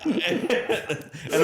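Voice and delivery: high laughing run-in to the sentence. Real, not acted. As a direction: still laughing as you start again. Laughs at coffee-shop story.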